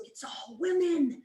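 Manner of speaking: high, slow, drawn-out lament; overwhelmed complaint. Retold or imitated. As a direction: imitate a bewildered friend's woe. Overwhelmed friend retold.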